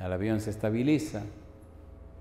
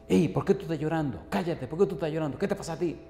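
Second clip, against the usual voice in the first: higher, rapid-fire nagging questions; mock-indignant scolding, pitched up; mimicked scolding friend; 6 semitones higher than usual. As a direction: imitate an annoyed friend telling someone off. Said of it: "que era un pichón de demonio".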